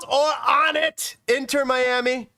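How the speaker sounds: high, drawn-out battle cry; hammed-up rousing send-off; rallying war cry. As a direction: send the team off like warriors, slowly proclaimed.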